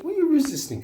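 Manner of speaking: coaxing pet voice. Real, not acted. Coaxing the budgie.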